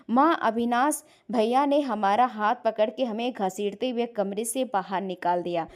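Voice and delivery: ordinary delivery, nothing marked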